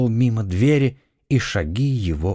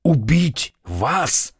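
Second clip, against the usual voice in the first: very slow, stunned question, slightly higher; horrified exclamation; 4 semitones higher, much slower than usual. A narrator's reading. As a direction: stretch each word in disbelieving horror. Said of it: "в ужасе вскрикнул Фельтон". (протяжный, потрясённый вопрос с ужасом)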